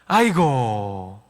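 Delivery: slow, low drawn-out sigh of a word; habitual sighing groan. Acted. Preacher showing his habitual saying, sighing groan. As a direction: let it sag down slowly. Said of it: "습관적으로 나오는 말이 있습니다".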